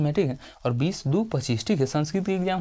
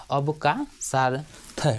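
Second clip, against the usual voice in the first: slow dictation; much slower than usual. Shown as a demonstration. Teacher dictating अवकाशार्थ to students, very slow. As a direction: stretch अवकाशार्थ out very slowly. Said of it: "teacher spells word for students".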